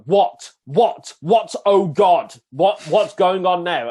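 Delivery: high, loud, alarmed repeated questions; alarmed disbelief. Retold or imitated. Panicked retold reaction to examiner.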